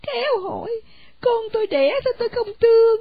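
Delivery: a mother hurt by the question; tearful retort. Acted. Mother answers through tears. (giọng bà mẹ vừa khóc vừa vặn lại)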